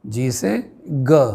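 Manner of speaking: slow, careful "जी से ग"; deliberate teacher pronunciation of "ग"; G sound. Shown as a demonstration. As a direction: spell out "ग" slowly, letter by letter. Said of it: "ग और बा में".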